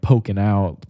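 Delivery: usual speaking voice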